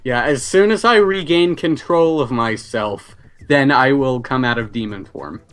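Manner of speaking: ordinary talk, nothing marked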